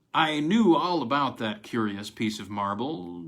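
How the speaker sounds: ordinary delivery, nothing marked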